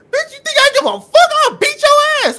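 high, loud imitated mom threat; furious threat. Mom threatening the lady with the taser, imitated loud threat. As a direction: roar it like an unafraid mom. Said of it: "she said".

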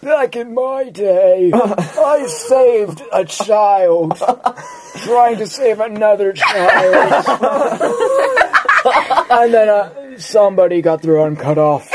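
put-on old prospector. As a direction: brag like a rambling old codger. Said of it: "Old People Talking".

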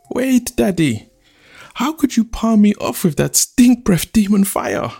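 high-pitched, outraged daughter; indignant protest. Acted. Daughter objecting to her arranged suitor, indignantly.

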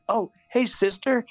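lightly feigned surprise, pitched high; mock surprise. Priest tricking kids into turning round, mock surprise. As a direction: pretend to spot someone, voice lifted. Fakes spotting a sister to distract kids.

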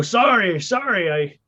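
high, flustered confession; stammered apology. Acted. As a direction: stumble through an apology to the police. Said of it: "I go".

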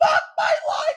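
high, loud mock-panicked yell; retold panic. Rider retelling the fast slide, panicked yell. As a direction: cry it out high like a terrified rider.

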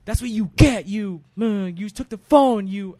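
loud, high mock yell; hammed-up shrieking at a thief. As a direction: shout down at the pinned kid. Ladies yelling at the thief.

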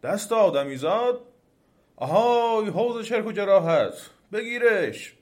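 loud, high, alarmed shout; demon shouting. A narrator's reading. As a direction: yell an order across the garden. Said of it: "دیو فریاد زد".

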